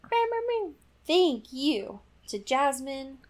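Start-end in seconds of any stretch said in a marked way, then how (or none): 0.0-0.7 pitched-up bam bam; high bam sounds. Pretend. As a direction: punch each bam up high. Sound effects.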